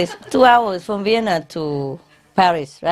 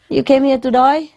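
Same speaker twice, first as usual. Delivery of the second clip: mock Australian; 7 semitones higher than usual. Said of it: "Why you talk like Australian?".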